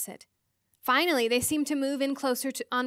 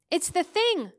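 alarmed cry; 7 semitones higher, 4 dB louder than usual. A narrator's reading. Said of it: "Charles Wallace cried".

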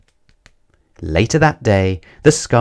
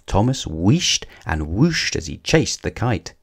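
ordinary delivery

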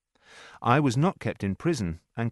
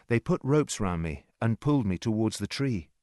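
usual speaking voice

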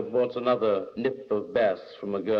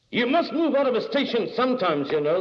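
high, louder pestering man; insistent man in buffet; 13 semitones higher, 4 dB louder than usual. A narrator's reading. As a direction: press the point, voice pitched up. Argumentative man in station buffet.

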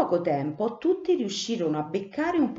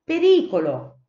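high loud alarm call; warning cry; 10 semitones higher, 7 dB louder than usual. Acted. Cipì explaining the plan, voicing the lookouts' loud warning. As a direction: shout the alarm.

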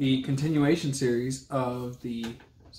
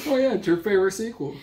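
teasing laugh; 7 semitones higher, 5 dB louder, much faster than usual. Real, not acted. Teasing cohost about only sequel.

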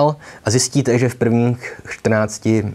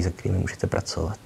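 whispered aside; 3 semitones lower, 10 dB quieter, faster than usual. Real, not acted. Quick aside to viewers.

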